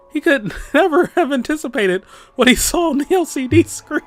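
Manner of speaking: real laughter breaking into high-pitched narration; laughing while reading. Real, not acted. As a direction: try to read on while cracking up. Reader cracks up mid narration.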